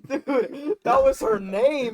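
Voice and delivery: high, loud, laughing protest; insist, still laughing. Real, not acted. Defends grandma's name laughing.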